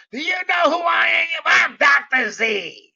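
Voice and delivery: loud, indignant shouting, pitched high and slowed on his name; retold angry rant. Doctor Z yelling at the employee over a free subscription, angry shout. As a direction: blow up as a self-important big shot demanding respect. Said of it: "He just went off".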